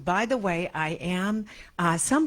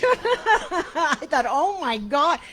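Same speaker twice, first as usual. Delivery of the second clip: laughing exclaim; 9 semitones higher, 6 dB louder, much slower than usual. Real, not acted. Laughing, recalling the realization.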